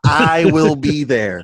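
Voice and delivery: slow, drawn-out vow of loyalty; defiant mock declaration. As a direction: stretch it out like a proud pledge. Defiant fan declaring he'll attend.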